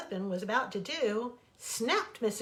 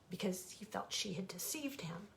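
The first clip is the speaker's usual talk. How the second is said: whisper; 2 semitones lower, 9 dB quieter than usual. A narrator's reading. Narration whispered.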